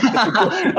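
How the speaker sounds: high burst of laughter; amused laugh. Real, not acted. Amused by accent question.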